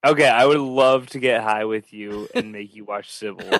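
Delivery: ordinary talk, nothing marked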